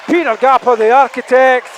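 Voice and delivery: huge high goal shout; long goal yell. Real, not acted. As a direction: scream the goal call. Goal scored, commentator erupts.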